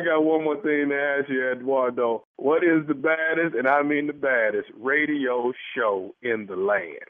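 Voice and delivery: slow, low showman's build-up; radio announcer. Acted. Radio host teases the show to the caller, slow announcer voice. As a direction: stretch it out like a radio promo.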